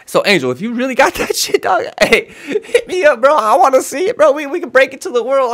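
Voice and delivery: loud, high, eager plea; excited call-out. Real, not acted. Reaction host begs Angel for his video, excited call-out.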